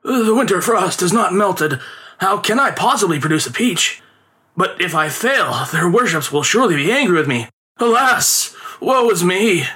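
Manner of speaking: high, theatrical lament; hammed-up woeful grumble, pitched high; loud complaining. A narrator's reading. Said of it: "complaining loudly that they had set".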